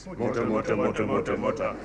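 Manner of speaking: low, soft crowd murmur; comic hushed rhubarb; mock muttering. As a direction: mumble it like men conferring. Crowd conferring by saying mutter.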